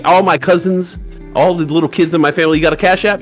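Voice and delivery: normal talking voice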